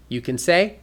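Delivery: ordinary talk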